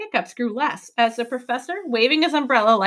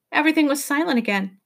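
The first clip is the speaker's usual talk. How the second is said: ordinary delivery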